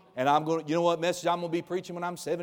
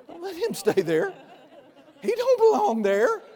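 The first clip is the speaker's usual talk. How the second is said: much higher and louder, laughing it out; laughing high exclamation; 10 dB louder than usual. Real, not acted. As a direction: laugh as you tell them to throw the devil out. Repeated warning, laughing high.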